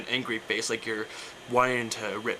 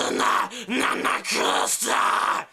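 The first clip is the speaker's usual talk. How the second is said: high, scratchy, squeaky creature shout; slow rasped cartoon screech; Stitch plus Hakalugi; 8 semitones higher, much slower than usual. Shown as a demonstration. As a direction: squeal it with a phlegmy rasp. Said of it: "you wanna combine them".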